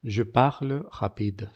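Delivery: PARLE and RAPIDE with R as a soft throaty J; slow demo, "parle rapide" with aspirated R; soft aspirated French R. Shown as a demonstration. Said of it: "Con la garganta así un poco".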